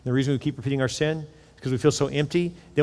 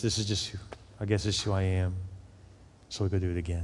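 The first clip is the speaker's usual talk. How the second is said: despairing inner voice; 7 semitones lower, 8 dB quieter than usual. Retold or imitated. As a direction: lower and much softer, sunk in shame. Voicing sinner's shameful inner thoughts.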